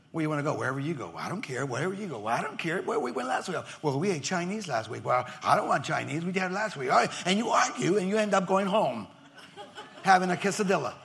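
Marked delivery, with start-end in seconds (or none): 0.1-6.9 low, soft, lightly voiced squabble; preacher acting out spouses fighting over where to eat; mock bickering couple. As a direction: mimic a married couple arguing quietly. Acted couple bickering over lunch.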